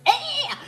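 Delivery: loud, high-pitched silly "eh!"; high yelp. Pretend. Teller caps a silly joke, loud high yelp. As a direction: let out a sudden squeal.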